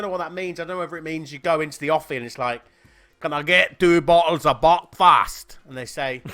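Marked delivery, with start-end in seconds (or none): 3.2-5.5 a lightly put-on Scottish accent, slow and louder. A customer asks the office for two bottles, in a light Scottish accent. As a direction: play a Scottish punter asking for his Buckfast, in a mild Scottish accent. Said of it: "he suggested my accent, my Scottish ac".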